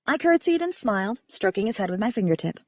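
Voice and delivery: ordinary delivery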